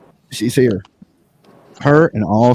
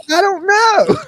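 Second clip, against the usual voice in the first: very high put-on voice; very high, clueless put-on voice; mock clueless lady; slower than usual. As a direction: squeak it out helplessly. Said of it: "she's like".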